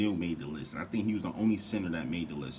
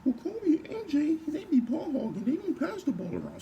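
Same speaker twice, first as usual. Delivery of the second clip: much higher, whiny mock fan; squeaky put-on fan voice, louder and mocking; mocking fans' voice; 5 dB louder than usual. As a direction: parody the haters in a silly high voice. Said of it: "let me say it in y'all voice".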